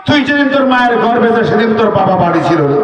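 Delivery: fast, pressing delivery; rushed reveal. Acted. The Prophet revealing the secret, fast and pressing. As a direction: rattle it off urgently. Rapid revelation.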